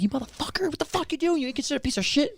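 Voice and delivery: high, mock-furious cursing at a driver; road rage. New Yorker cursing at the driver who cut him off, mock rage.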